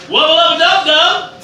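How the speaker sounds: loud, high Rick yell; Rick's catchphrase. Retold or imitated. Host quoting Rick's drinking-game catchphrase, loud and high. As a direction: shout it like Rick. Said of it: "if Rick says".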